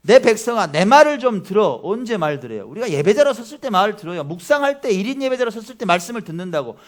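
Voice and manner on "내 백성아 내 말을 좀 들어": louder, higher voice of God calling his people; preacher voicing God's plea, louder; God's urgent call. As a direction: call out as God, urging them to listen.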